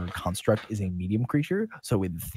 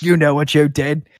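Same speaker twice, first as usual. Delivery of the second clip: loud, raised accusing voice; guard scolding; 6 semitones higher, 11 dB louder than usual. Acted. Guard shaking a kid by the arms, loud accusation. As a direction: lay into the prisoner. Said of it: "shakes him a little bit and goes".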